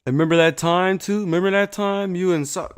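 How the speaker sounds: loud eager anime retelling; excited recall. Real, not acted. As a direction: hype up the memory.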